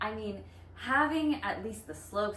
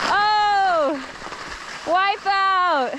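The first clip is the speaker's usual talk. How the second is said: high loud yelp; loud cry; 10 semitones higher, 12 dB louder, much slower than usual. Real, not acted. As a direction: yelp it out as you fall. Falling down on skis.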